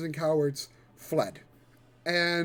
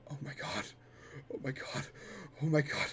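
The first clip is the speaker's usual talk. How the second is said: dazed murmur; 3 semitones lower, 11 dB quieter, much faster than usual. Acted.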